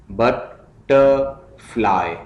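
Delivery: Butterfly slowly in three beats, बट, टर, fly; split into syllables. Shown as a demonstration. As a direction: pause between each syllable of butterfly. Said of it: "syllables में divide करना है".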